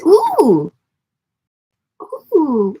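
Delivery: high, loud delighted ooh; excited. Real, not acted. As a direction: squeal with delight. Excited reaction to viewer comment.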